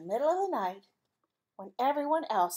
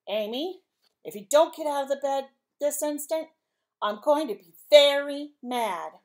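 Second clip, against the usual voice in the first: louder, slowing stern dad warning; sternest father voice; 4 semitones higher, 8 dB louder than usual. Acted. Father at Amy's bed, stern and louder. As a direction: warn her like a strict father. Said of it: "in his sternest father voice".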